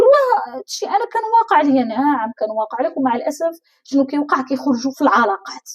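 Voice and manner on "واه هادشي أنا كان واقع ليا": sudden realization. Retold or imitated. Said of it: "أمور اللي كتقول".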